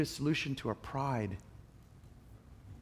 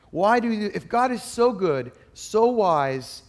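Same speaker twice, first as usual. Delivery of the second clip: the question pushed louder and higher; louder, higher repeat; 6 semitones higher, 6 dB louder, slower than usual. Real, not acted. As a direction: lean harder into the repeat. Preacher repeats the question, building.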